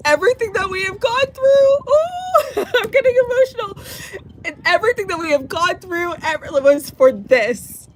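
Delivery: high, breaking voice through real tears; crying while speaking, voice cracking; tearful and overwhelmed, pitch far up. Real, not acted.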